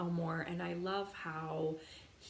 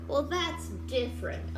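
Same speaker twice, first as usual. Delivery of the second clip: higher, perking up; brightening Elmore; 6 semitones higher, 3 dB louder than usual. Acted. Elmore answering his uncle, cheering up. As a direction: let him cheer up a little. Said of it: "Elmore replied".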